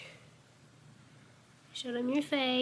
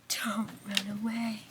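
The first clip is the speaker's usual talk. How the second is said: soft whisper; 5 dB quieter than usual. Real, not acted. Whispering to the cat so she doesn't run off. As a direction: hush it to the cat.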